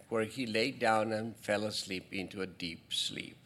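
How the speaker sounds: ordinary delivery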